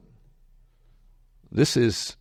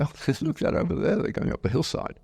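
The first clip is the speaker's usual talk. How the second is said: put-on old villagers pointing; 9 semitones higher than usual. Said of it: "They say".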